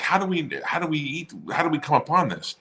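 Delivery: high, halting imitation of a question; retold puzzled question. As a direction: pitch it up, retelling someone's wondering. Retold uncle's question, pitched up.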